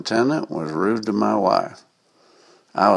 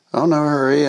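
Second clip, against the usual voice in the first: lightly acted innocence, pitched higher; feigned innocence; 6 semitones higher, 3 dB louder than usual. A narrator's reading. Driver answering the trooper who stopped him, lightly innocent. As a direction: play innocent, voice lifted a little. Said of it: "I innocently said".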